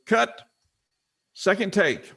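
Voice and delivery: high, loud director's call; background director. Retold or imitated. Director stopping a commercial shoot, high loud call. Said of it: "you hear a person in the background".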